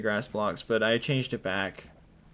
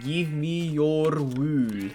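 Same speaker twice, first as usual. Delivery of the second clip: very slow, higher-pitched chant; playful drawn-out demand; 4 semitones higher, much slower than usual. Pretend. Player demanding wool from a sheep, playfully drawn-out. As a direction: stretch every vowel in a silly demand.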